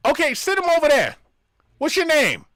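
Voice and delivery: loud and high, shouting at a customer; mock loud shop clerk; loud tone-deaf guy. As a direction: bellow a friendly greeting too loud. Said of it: "He talks to me like this".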